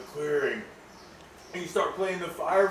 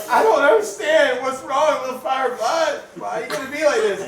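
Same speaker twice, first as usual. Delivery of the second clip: upset confusion; 8 semitones higher, 7 dB louder than usual. Acted. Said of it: "And you're like".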